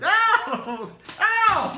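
loud high yelp; pained ow. Real, not acted. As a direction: yelp as if struck. Hit on the head with ball.

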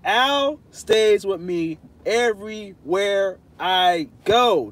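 slow, drawn-out and emphatic. Pretend. To chat doubting the friendship, slow emphatic insistence. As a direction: spell it out slowly for them. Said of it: "I love to talk like this".

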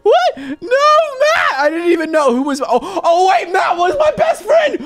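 high half-laughing wail; anguished cry. Real, not acted. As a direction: call after a departing friend. Friend Matt leaving.

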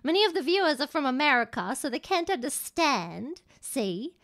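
played-up English accent. Streamer explaining to co-host about American viewers, English accent. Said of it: "isn't that English accent".

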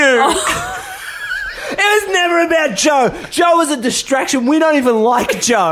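high, loud hammed-up villain twist; mock dramatic reveal. To Jess, revealing the prank was about him, hammed-up shouted reveal.

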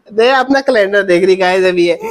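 usual speaking voice

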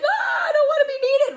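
high, shouted mock wail; mock panicked men. Men fleeing someone needy, mock panic. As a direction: recoil with a hammed-up cry. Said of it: "The men are like".

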